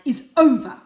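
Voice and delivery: loud, contemptuous; spat. Acted. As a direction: spit the words out. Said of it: "spat Father".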